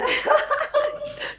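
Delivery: squealing happy laugh; delighted high laugh. Real, not acted. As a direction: burst into a high giggle. Host laughs greeting guest.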